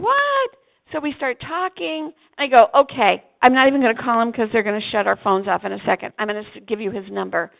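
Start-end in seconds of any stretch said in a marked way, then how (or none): 0.0-0.5 very high amazed What; imitating his stunned surprise; astonished what. Young man astonished. 2.8-7.5 hurried. Retold or imitated. Hurrying before phones shut off.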